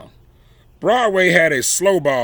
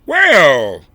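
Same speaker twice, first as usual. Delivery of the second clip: usual speaking voice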